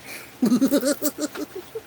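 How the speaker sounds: high giggly sinister cackle; evil clown laugh. Shown as a demonstration. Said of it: "I can do an evil clown laugh".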